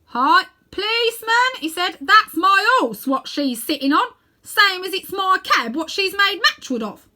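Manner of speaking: high, loud, slow protest; indignant shout. Acted. Red-faced cabby shouting his claim at the policeman, indignant and loud. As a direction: shout your claim over the crowd.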